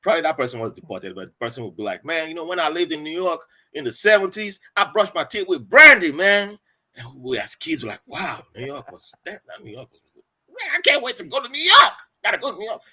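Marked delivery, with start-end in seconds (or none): 2.0-6.6 a hint of a bragging older uncle, higher and louder; boastful uncle. Uncle boasting to wide-eyed kids, lightly voiced bragging. 8.1-12.8 put-on high, starry-eyed kid voice; awed kids. Kids dreaming of New York after uncle's tale, awed gush.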